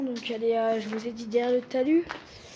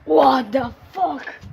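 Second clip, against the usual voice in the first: shocked shout; 6 dB louder, much slower than usual. Real, not acted. Shocked at something on the crane.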